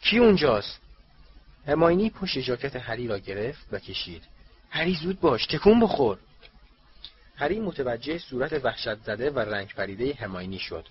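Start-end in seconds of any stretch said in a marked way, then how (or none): 0.0-0.8 high, loud challenge; shouting out. A narrator's reading. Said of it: "او دوباره فریاد زد". 4.7-6.2 higher-pitched, pressing command; urgent urging. A narrator's reading. Hermione urgently pulls Harry away.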